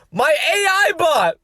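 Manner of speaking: exasperated reveal. Real, not acted. Embarrassed reveal to viewers, loud and drawn out. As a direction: spell it out in embarrassed disbelief. Reveals best friend is bot.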